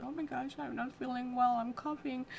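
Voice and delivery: lightly acted anxious complaint; mock panic. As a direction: imitate a friend fretting about being sick. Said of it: "stirring the panic".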